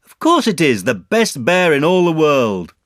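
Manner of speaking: high, loud, glowing praise; delighted. A narrator's reading. Christopher Robin presenting Pooh's gift, delighted. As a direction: proclaim it happily, stretching the praise.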